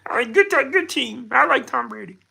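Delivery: high Kermit-style frog voice doing Patrick Mahomes; high Kermit the Frog voice, mock football star. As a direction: squeak it out like Kermit playing a quarterback. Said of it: "Patrick Mahomes impression, A K Kermit the Frog".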